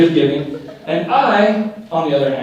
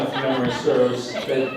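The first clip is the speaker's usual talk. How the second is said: ordinary talk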